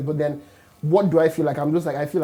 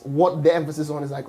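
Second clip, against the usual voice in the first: usual speaking voice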